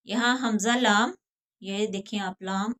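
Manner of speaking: normal talking voice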